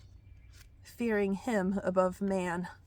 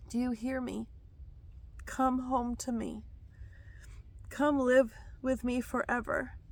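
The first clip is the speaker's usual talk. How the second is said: lifted, tender voice of the Lord; prophetic word; 3 semitones higher than usual. Acted. The Lord inviting his children home, lifted and tender. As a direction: call them home gently.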